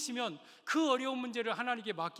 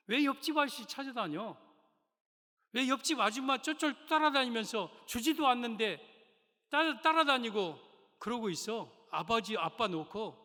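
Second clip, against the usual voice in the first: angry parent; 5 semitones higher, 6 dB louder than usual. Pretend.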